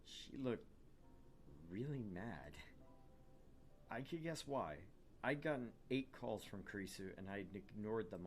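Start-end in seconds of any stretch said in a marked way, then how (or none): none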